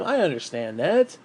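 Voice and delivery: normal talking voice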